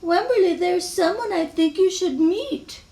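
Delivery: high, lively teacher's voice; eager teacher, pitched well up; Missus Peachum. Acted. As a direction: introduce a new pupil in a high lilt. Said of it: "Then Missus Peachum said".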